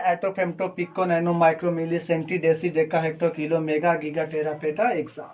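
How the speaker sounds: low, even run through the list; rapid recital. Shown as a demonstration. Teacher showing students how to recall all the prefixes, rapid recital. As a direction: rattle the prefixes off from memory. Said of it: "एकदम speed में".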